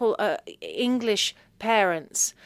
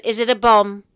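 louder, blunt mock question from mum; mum's question; 11 dB louder than usual. Mum asking if the parcel is a bomb, louder. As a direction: ask it straight out, louder. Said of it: "She said".

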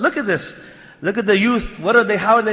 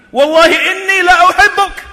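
"Wallahi inni la uhibbuk" high and loud throughout; full emphasis; 11 semitones higher, 8 dB louder than usual. Shown as a demonstration. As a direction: proclaim every word of "Wallahi inni la uhibbuk". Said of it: "how many emphasis is there?".

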